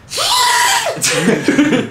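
very high, wordless distant scream; high-pitched scream. Acted. Game master acts out a distant screaming voice, high-pitched scream. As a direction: shriek far above your own voice. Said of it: "some very high-pitched voice that seems to be screaming".

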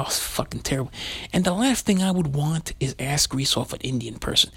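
usual speaking voice

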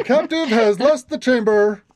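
booming announcer voice, laughing at first; magically amplified voice. Acted. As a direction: boom it out like an alarm. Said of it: "A deep booming voice says".

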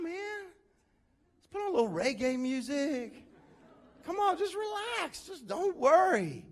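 high, airy chill-out voice; hammed-up carefree guy telling you to relax; laid-back dismissive Paul. Paul brushing off a worried believer, mock laid-back and high.

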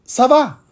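"ça va" lifted high and crisp; French model answer. Shown as a demonstration. Teacher showing learners the reply, clear French. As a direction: present "ça va" as a clean model. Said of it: "la respuesta es".

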